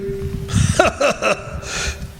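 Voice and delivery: short breathy laugh of amusement; amused chuckle. Acted. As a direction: chuckle as you catch on to the trick. Amused at being tested.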